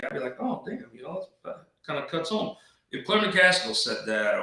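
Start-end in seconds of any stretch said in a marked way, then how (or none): 0.4-2.6 softer, higher mock-hurt reply; mock-wounded. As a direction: act lightly stung, hushed and higher. Imagined reaction to respected critic.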